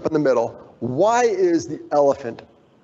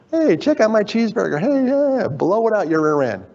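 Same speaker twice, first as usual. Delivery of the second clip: 1 mock social media boaster; 6 semitones higher than usual. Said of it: "everybody sharing their joy".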